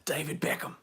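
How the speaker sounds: laughing, whispered lightly British-voiced name; a light, breathy stab at a footballer's voice; David Beckham impression. Host teasing his UK followers: whispered light Beckham voice. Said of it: "when I do my David Beckham impression".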